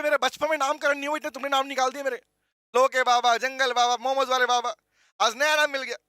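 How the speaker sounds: usual speaking voice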